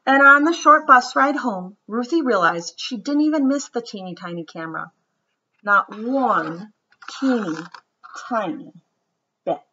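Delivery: gentle storybook read-aloud; children's story narration. A narrator's reading. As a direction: read it like bedtime story time. Reads picture book aloud.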